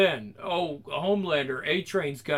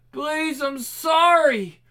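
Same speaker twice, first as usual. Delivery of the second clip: high, slow, desperate plea; terrified begging; 9 semitones higher, 5 dB louder, much slower than usual. Acted. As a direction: beg in terror. Captive begs.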